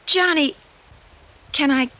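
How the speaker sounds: giddy disbelief, asking permission; thrilled stammer; overwhelmed. Acted. Told she inherited necklace.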